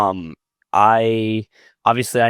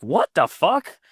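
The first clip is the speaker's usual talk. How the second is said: higher-pitched incredulous question; retold astonishment; 6 semitones higher, slower than usual. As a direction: act out being floored by the news. Retelling his astonished reaction.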